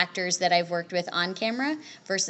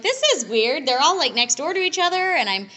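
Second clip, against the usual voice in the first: higher, louder, re-enacted amazement; pitched-up retelling of being weirded out; bemused disbelief; 7 semitones higher, 5 dB louder than usual. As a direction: voice your own giddy disbelief at the situation. Voicing own amazed reaction on set.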